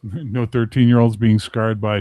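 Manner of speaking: ordinary talk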